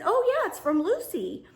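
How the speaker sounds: a hint of a cheerful courier; imitating the delivery man. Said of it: "And he said".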